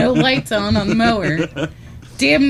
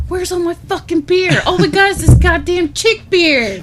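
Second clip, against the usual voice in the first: high, loud indignant outburst; hammed-up furious complaint; angry husband; 9 semitones higher, 6 dB louder than usual. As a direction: rage about the wrong beer. Acting angry husband finding wrong beer.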